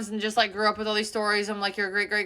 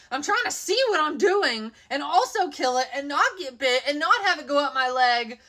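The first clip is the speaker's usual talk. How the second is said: usual speaking voice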